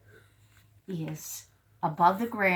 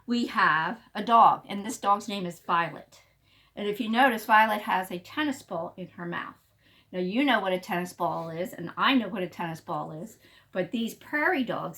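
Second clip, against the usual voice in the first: plain reading voice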